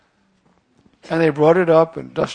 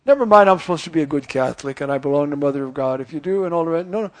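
normal talking voice

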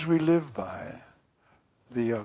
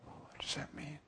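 whispered, much softer question; 17 dB quieter than usual. Acted. Preacher pondering the name I am, in a whisper. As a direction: breathe the question as a hushed whisper.

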